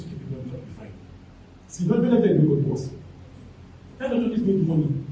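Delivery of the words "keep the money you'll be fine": very soft aside. Real, not acted. Trailing off after blessing.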